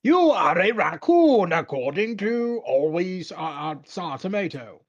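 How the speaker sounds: loud, high, very slow announcer; grand game narration. Acted. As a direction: proclaim it with stretched-out words. Reads game text in grand narrator style.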